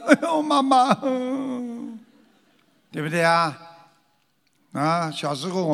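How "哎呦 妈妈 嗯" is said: mock child's cry. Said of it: "你再不听我的话呢".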